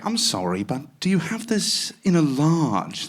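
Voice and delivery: slow, polite put-on British voice; overdone British politeness; British accent. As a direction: ask sweetly in a posh English accent. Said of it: "I don't use this voice".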